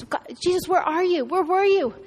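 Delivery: mild, pleading reproach; anguished questioning. Retold or imitated. Grieving sisters looking at Jesus, anguished questioning.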